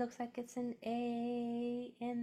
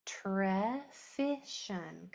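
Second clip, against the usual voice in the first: slow repeat; much slower than usual. Shown as a demonstration. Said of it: "Kinda hard".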